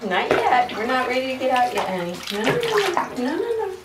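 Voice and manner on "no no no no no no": high, quick alarmed noes; urgent no no. Real, not acted. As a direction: stop the dog from jumping. Dog trying to get out.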